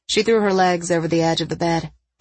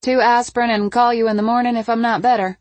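called out louder and higher; raised voice; 5 semitones higher, 5 dB louder than usual. Acted. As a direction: call it across the room. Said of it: "She raised her voice".

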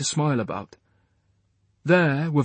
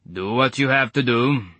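put-on Eastern European accent. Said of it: "in his gloriously thick Eastern European accent".

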